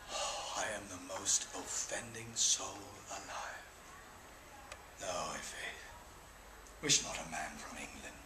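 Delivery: very soft, low whispered confession; hushed whisper. Acted. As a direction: drop to a near-silent whisper. Intimate hushed passage of speech.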